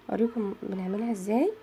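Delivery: usual speaking voice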